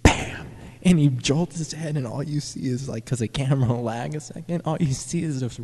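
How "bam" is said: whispered bam. Retold or imitated. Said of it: "he goes".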